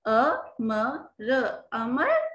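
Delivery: अ म र, then अमर, slowly joined; sounding out letters. Shown as a demonstration. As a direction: blend letters slowly for a child. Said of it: "अ की sound दे रहा है". (अक्षर जोड़ते हुए धीमे)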